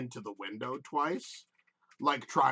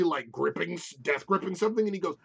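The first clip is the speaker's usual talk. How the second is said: ordinary delivery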